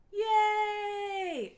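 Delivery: high excited cheer; big happy yay. Acted. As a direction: celebrate the child's win. Child got all answers.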